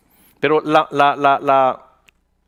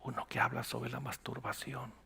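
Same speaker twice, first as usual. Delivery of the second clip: hushed and much softer, embarrassed; very quiet; 3 semitones lower, 20 dB quieter than usual. Retold or imitated. Pastor imitating a caller confessing the topic, hushed. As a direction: say it almost under your breath. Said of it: "hablaba muy bajito".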